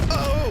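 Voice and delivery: high, loud "oh" cry; sudden high yelp; startled cry. Real, not acted. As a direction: yelp in surprise at the game. Reacts to mishap in game.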